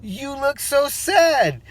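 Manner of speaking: high, slow, sympathetic teacher voice, imitated; teacher's concern. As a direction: mimic the teacher, high and drawn out with pity. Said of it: "She said".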